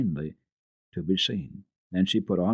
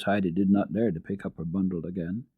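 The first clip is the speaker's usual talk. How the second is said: plain reading voice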